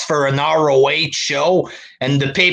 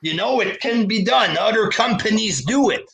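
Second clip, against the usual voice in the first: ordinary talk, nothing marked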